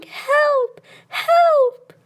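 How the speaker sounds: put-on very high, rising scared child's calls; crying for help. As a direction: scream for help running away. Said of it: "ran out of the cottage crying".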